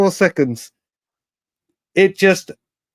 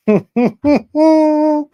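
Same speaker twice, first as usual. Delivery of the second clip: shocked laugh; 12 semitones higher, 3 dB louder than usual. Real, not acted. Breaking news shock.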